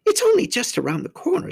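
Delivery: high-pitched faun character voice; coaxing faun. A narrator's reading. Faun urging the girl to visit, high and coaxing. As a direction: coax lightly, pitched well up.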